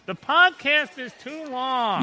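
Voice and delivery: slow, exaggerated grumble; mock complaint. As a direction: drag it out like a griping listener. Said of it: "where people start to complain".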